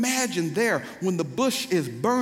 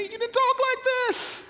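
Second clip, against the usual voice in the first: high incredulous squeal. Acted. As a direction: leap into a very high falsetto. Preacher mimics a scandalised reaction.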